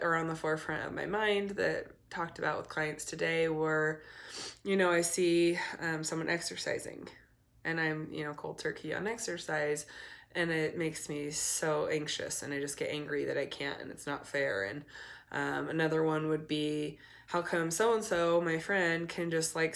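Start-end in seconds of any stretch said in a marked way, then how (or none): none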